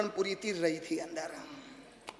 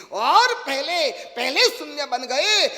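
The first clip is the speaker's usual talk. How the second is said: loud, slow preaching; preached emphasis; 5 semitones higher, 10 dB louder, slower than usual. Acted. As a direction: drive the point home loudly and slowly. (ज़ोर और धीमेपन से उपदेश)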